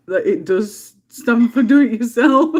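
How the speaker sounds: barely holding in a laugh while agreeing. Retold or imitated. Retelling her answer to Brian, loud and laughing. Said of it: "I'm like".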